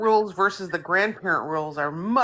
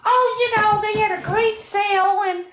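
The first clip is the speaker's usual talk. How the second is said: mom's voice; 13 semitones higher than usual. Retold or imitated. Daughter imitating her mom bragging about a sale. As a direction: chirp it like a bargain-happy mom.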